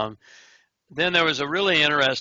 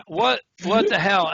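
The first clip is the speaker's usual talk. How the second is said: higher-pitched, amused retort; retold laughing disbelief; 8 semitones higher than usual. Inmate laughing at being called a chair, retold amused disbelief. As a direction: imitate someone laughing off a silly insult. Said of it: "He, he laughs at me".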